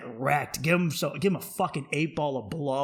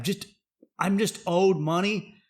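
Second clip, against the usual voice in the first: ordinary talk, nothing marked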